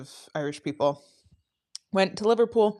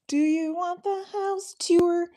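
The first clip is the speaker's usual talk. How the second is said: sung high and slow in a heavy Canadian accent; playful Canadian-accent singalong; 12 semitones higher, slower than usual. Shown as a demonstration. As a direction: croon it like a Canadian pop singer, high and drawn out. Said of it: "saying tour like Shane with a heavy Canadian accent".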